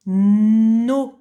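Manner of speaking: Na said loud and clear; dictation. Shown as a demonstration. Teacher dictating a syllable to the child, loud and clear. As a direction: pronounce Na for dictation. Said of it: "tu écris no".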